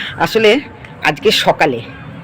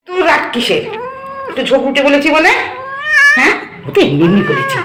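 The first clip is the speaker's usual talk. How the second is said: playful teasing; 10 semitones higher than usual. Acted. Teasing the sulking pet.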